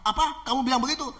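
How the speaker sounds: high-pitched indignant challenge; acted outrage at being defied; angry robber. Robber challenging the defiant pastor, angry. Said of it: "ini perampok ini marah-marah".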